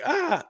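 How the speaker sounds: a sudden high yelp while dodging; quick high startled yelp; mock yelp. Kanan's like.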